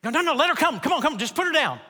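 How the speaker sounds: high, quick, excited pleading; eager urging. Retold or imitated. Dog owner urging the couple to let the dog go, high and eager. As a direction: hurry them to put the dog down.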